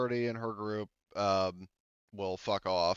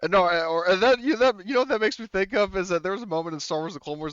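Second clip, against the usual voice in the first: excited; 9 semitones higher, 7 dB louder than usual. Real, not acted. Excited riff on a Star Wars moment.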